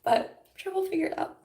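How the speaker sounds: high, whispered through laughter and held-back tears; tearful whisper. Real, not acted. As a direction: whisper it as if you're about to cry. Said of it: "trying not to cry".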